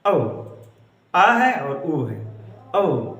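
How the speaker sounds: औ said whole, then slowly as आ and ऊ; slow teaching demo of औ; diphthong split. Shown as a demonstration. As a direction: show औ as आ sliding into ऊ. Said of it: "ऐसे इसमें है".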